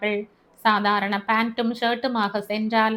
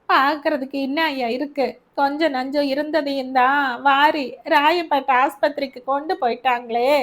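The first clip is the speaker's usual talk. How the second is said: put-on grieving old mother; 4 semitones higher than usual. Mother lamenting her dead son to Albert, higher and aggrieved. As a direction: wail at God over her son's fate.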